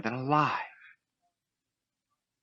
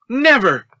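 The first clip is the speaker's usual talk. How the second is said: much higher, louder final cry; defiant shout; 10 dB louder than usual. Acted. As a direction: shout your last defiance. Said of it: "Luke shouted".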